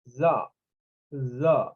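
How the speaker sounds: THE as a lone low schwa; schwa syllable. Shown as a demonstration. Teacher demonstrating the schwa sound to students, THE with a schwa. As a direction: drop THE low on a neutral schwa vowel. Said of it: "is the schwa sound".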